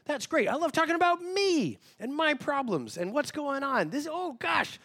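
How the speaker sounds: high, bubbly mock enthusiasm; eager oversharer. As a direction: play the keen one who loves to talk about themselves. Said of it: "some of you are like".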